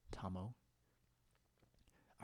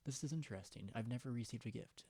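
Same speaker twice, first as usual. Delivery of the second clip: normal talking voice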